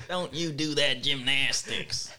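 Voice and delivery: slow Southern drawl, scolding; a Southern stepdad scolding you; southern accent. Retold or imitated. Friend imitating the stepdad scolding breaking, slow Southern accent. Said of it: "I just gave him a freaking southern accent".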